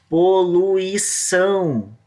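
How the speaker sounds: "Poluição" drawn out slowly, last syllable leaned on; stress on final syllable. Shown as a demonstration. Teacher showing students the stressed syllable, slow and deliberate. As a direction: stretch "Poluição" out so the final "ção" carries the weight. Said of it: "o são já tá aqui já".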